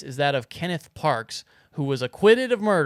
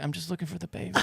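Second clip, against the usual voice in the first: soft hushed pleading at the door; hushed mock sleepwalking mom; sleepwalker whisper; 2 semitones higher, 9 dB quieter, much faster than usual. Mom voiced again.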